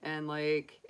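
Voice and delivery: ordinary delivery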